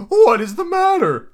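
very high, put-on falsetto ghost; spirit's question. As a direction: ask it way up high as an otherworldly spirit. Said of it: "asked the spirit".

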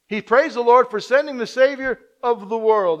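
proclaiming loudly from the pulpit; full-force preaching. Acted. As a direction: preach it out with fervour.

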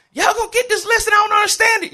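high, loud frantic teacher yell; snapped yelling. Retold or imitated. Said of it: "I was yelling in class one day".

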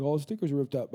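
usual speaking voice

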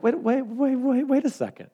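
a rising, pretend double-take; incredulous. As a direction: stop yourself in disbelief at the verse. Reacting in disbelief to the verse.

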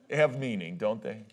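ordinary delivery

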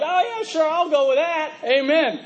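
high, breezy mock self-satisfied voice; smug agreement. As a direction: play the smug churchgoer, lightly. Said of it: "you're like".

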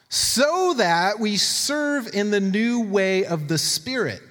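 high, slow declaiming of scripture; emphatic scripture reading. Acted. Preacher reads Romans to the church, emphatic scripture reading.

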